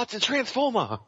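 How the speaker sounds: high-pitched mock Boston accent; bad Boston accent. Host mimicking the Boston actor, high bad Boston accent. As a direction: attempt Boston, landing nearer English. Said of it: "my Boston accent comes out sounding more English".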